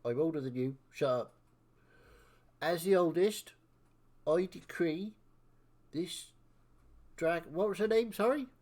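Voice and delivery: self-important senior of the hunting party; bossy elder. Acted. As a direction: pronounce the ruling in halting, weighty chunks. Elder decrees dragon joins party.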